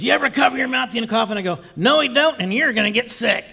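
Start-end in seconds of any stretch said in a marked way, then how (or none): 0.0-1.3 high, rapid mocking replay; mimicked scolding. Teller repeats the rude passenger's line, quick high scold. 1.7-3.4 high, punchy comeback; acted angry retort. Passenger defends coughing old man, high indignant retort.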